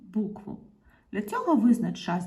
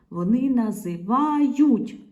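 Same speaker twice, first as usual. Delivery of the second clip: slow, loud "називають", syllables stretched; emphatic sounding out; 3 semitones higher, 6 dB louder, slower than usual. Shown as a demonstration. Teacher putting the verb with вони, emphatically drawn out. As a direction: draw out НАЗИВАЮТЬ to expose the ending.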